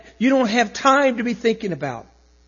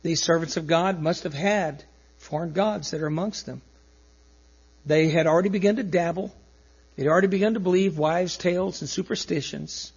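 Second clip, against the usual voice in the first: ordinary delivery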